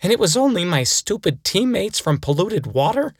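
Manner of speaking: put-on outraged kid. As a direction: build from disbelief into a mock tantrum. Kid realizes he was lied to.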